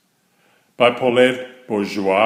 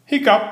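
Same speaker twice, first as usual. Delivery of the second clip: high, sudden hiccup sound; spoken hiccup, sharp and high; 10 semitones higher, 3 dB louder than usual. Acted. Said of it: "went Franklin".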